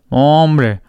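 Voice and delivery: ordinary talk, nothing marked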